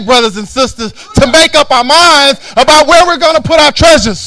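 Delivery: loud, rising preacher's rebuke; preaching at full force. Acted. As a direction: hammer it home from the pulpit. Sermon building to a shout.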